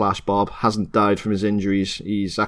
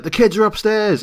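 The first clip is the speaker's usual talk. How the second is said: mock alarm; 11 semitones higher than usual. Everyone downstairs raises the alarm.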